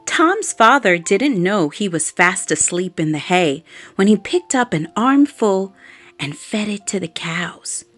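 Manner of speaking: usual speaking voice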